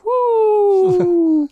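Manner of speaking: shrill mock woo cry; high woo. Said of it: "she goes".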